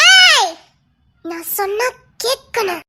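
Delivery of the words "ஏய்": high loud yelp of protest; playful scolding yell; sharp shout. Acted. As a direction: snap at him to stop. Wife scolds husband playfully.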